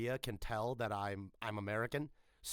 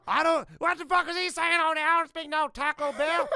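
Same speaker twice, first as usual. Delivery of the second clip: loud comic impression; 10 dB louder than usual. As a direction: holler it like the clip guy, way up high. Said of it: "Do you know what I'm referencing?".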